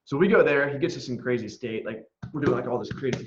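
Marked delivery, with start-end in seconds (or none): none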